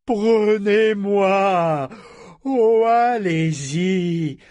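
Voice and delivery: very slow, loud, high crazed figurehead voice; savage mad taunt. Acted. The figurehead taunting the boarders, savage crazed taunt. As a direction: lure them in like a mad ship spirit. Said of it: "éclata d'un rire sauvage".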